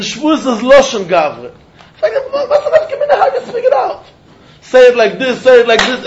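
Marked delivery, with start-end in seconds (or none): 1.9-4.0 high-pitched acted puzzled question. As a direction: ask it way up high, as a challenge. Acts out a puzzled objection to Gemara.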